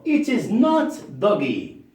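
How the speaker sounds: It is not doggy, slow and deliberate; slow teacher model; slow model sentence. Shown as a demonstration. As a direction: spell it out slowly for pupils. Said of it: "No, no, no".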